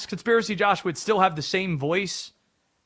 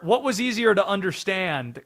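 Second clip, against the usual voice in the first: ordinary talk, nothing marked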